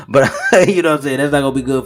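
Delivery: laughing. Real, not acted. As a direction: chuckle it out at the absurdity. Laughs at grim scenario.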